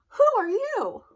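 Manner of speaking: high, loud startled cry; startled exclamation. Acted. Sheep bumps into the hairy stranger, startled high cry. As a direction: yelp it in surprise. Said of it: "she said".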